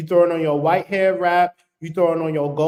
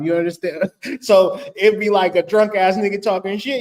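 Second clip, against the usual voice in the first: normal talking voice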